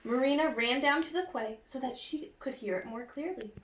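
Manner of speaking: normal talking voice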